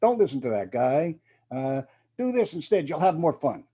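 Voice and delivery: a hint of a Brooklyn wise guy, coaxing; Brooklyn devil. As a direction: tempt like a little Brooklyn devil on your shoulder. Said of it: "make him talk like a guy from Brooklyn".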